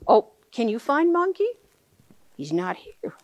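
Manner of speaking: ordinary delivery, nothing marked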